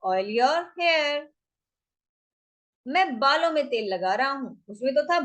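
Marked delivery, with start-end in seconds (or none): 0.0-1.2 drawn-out teaching delivery; slow demo phrase; slow model phrase. Shown as a demonstration. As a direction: stretch it so learners can follow. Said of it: "बोलते ना".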